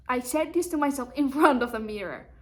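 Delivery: ordinary delivery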